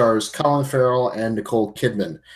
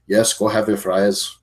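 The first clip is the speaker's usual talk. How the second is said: mock Irish accent; 2 semitones lower than usual. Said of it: "Is that, is that your Irish accent".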